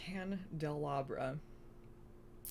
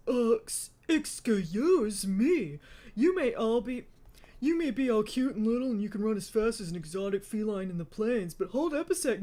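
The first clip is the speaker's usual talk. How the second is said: loud, higher-pitched indignant comeback; sassy mocking; 5 semitones higher, 9 dB louder than usual. A narrator's reading. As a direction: hold up and mock her with big attitude. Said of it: "Dylan mocked Shiraz".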